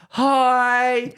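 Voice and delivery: put-on high, loud child's call from afar; kid yelling hi. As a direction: shout it like a six-year-old over a fence. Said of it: "like, six-year-old yell out from the fence".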